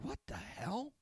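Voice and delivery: high startled what the hell; shocked disbelief. Retold or imitated. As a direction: squeak it out in shock. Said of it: "I'm like".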